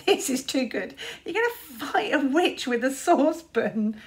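mock him through laughter; a sneering big brother. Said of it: "sneered Luther".

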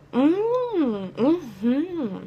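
humming with closed lips; muffled through food; chewing, mouth full. Real, not acted. Seen 1: Bit brownie at -2, lips closed chewing throughout the hums.

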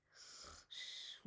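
voiceless shh, very soft; soft shush. A narrator's reading. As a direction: hush them to sleep. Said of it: "went Lulu".

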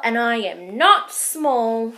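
loud, firm protest; shouted. Acted. Baby Owl protesting to Rabbit, loud insistence. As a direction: insist loudly. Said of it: "shouted Baby Owl".

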